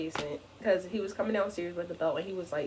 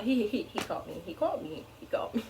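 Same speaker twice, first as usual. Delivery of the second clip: ordinary talk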